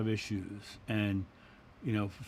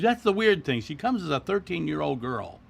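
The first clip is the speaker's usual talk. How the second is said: higher, louder puzzled woman's voice; 6 semitones higher, 5 dB louder than usual. Retold or imitated. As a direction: voice her bafflement up high. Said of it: "And she says".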